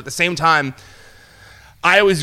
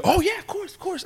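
very high, softer chorus of agreement; eager agreement; 6 dB quieter than usual. Retold or imitated. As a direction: imitate keen, agreeable strangers. Retold strangers eagerly agree.

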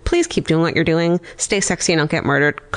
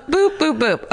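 silly boops; 9 semitones higher, slower than usual. Acted. Silly sound effect noises.

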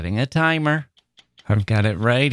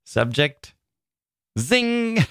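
"Zing" rung out high after "Subject"; exclamation-mark reading; 6 semitones higher, much slower than usual. Shown as a demonstration. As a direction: leap high on "Zing" and hold it. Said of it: "That's how I hear it in my head".

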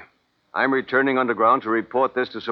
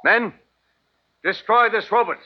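a raised, higher command; barked order; 6 semitones higher than usual. Acted. Colonel to his men, a raised angry command.

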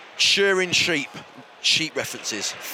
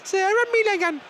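Sarah Millican impression; 11 semitones higher than usual. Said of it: "you gotta talk like that".

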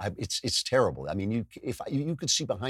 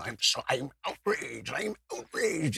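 strained, drawn-out whine of outrage; hammed-up, stretched-out indignation; mock outrage; 3 semitones higher, 4 dB quieter, slower than usual. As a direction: imitate an angry person, soft but seething. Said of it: "like, angry people".

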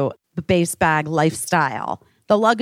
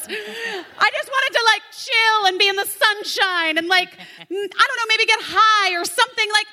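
pitched-up, wistful whine; high whiny wish; 12 semitones higher than usual. Retold or imitated. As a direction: whine it like a kid denied a fun day. Whining about the lost day.

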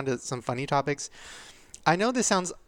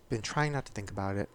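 usual speaking voice

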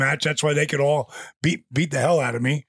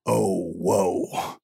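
dazed dismay; 10 semitones lower than usual. Retold or imitated. Wrestler seeing trouble backstage, dazed dismay. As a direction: let it sink out low as it dawns on you.

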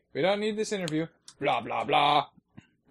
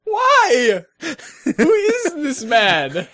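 laughing disbelief; 12 semitones higher, 11 dB louder, much slower than usual. Real, not acted. Shocked at bath selfie.

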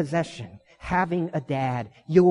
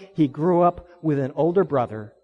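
usual speaking voice

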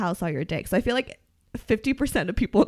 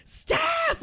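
calling out. Retold or imitated. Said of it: "like, screaming for me".

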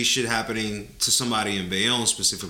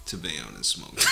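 usual speaking voice

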